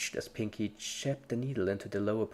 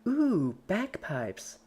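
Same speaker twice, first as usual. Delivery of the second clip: high squeal of delight; thrilled by a new toy; delighted Pinkie; 9 semitones higher, 3 dB louder, much slower than usual. A narrator's reading.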